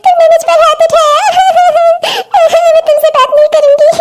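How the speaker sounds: high-pitched, sobbing reproach; tearful hurt. Acted. Woman tells the man who hit her she is done talking, tearful hurt. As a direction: cry it out, wounded and accusing. Struck woman in acted quarrel.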